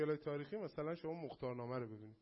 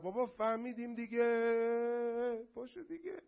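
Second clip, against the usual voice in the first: exasperated mock complaint; 7 semitones higher, 5 dB louder, slower than usual. Mocking fed-up audience.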